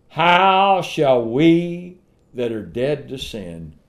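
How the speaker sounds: loud, very slow scripture quote; preacher ringing out a verse; declaimed scripture. Acted.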